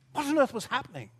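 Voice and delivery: ordinary delivery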